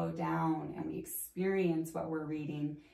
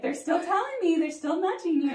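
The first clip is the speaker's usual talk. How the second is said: much higher, louder mock nagging voice; imitating insistent healer; 12 semitones higher, 9 dB louder than usual. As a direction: pester her playfully, pitched way up. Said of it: "every time she'd be like".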